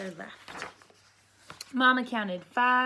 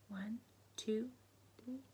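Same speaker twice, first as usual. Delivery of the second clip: whispered counting; 12 dB quieter than usual. Real, not acted. Teacher whispering the count to children, whispering. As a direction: count softly under the breath.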